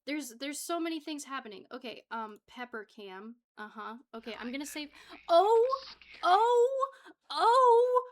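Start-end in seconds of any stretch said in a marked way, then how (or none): 5.3-8.0 high, loud crying oh; a real distressed cry; panicked wail. Real, not acted. Panic, crying sound heard.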